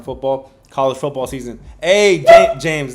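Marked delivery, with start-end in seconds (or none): 1.8-2.9 loud call across the room to James. Real, not acted. Host calls out to James across the room, loud call. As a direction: shout his name to get James's attention.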